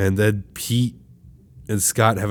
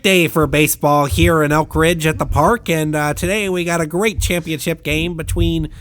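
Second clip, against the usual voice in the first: play-by-play like a cheap Vin Scully; mock sportscaster; 7 semitones higher than usual. Friend hamming up the baseball commentator for the podcast. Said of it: "a discount Vin Scully who is commentating this".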